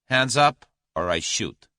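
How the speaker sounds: sudden loud command, then levelling; abrupt threat. A narrator's reading. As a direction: switch abruptly to holding him at gunpoint. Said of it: "with an abrupt change of manner".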